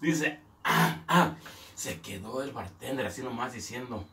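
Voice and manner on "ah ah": breathless, voiceless mute grunts; mute grunting. Retold or imitated. Mute customer grunting at the bartender. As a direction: grunt like someone who cannot speak.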